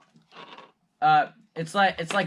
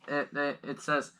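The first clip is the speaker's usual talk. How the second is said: ordinary talk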